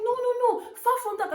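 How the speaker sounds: high, fast, flustered imitation; mock scared agent. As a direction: panicky denial of the agent.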